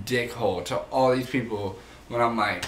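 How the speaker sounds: usual speaking voice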